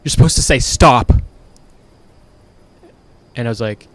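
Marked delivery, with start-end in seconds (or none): 0.0-1.1 raised, higher voice, imitating mom; mother yelling. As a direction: yell it like a scolding mother. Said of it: "she yells at me".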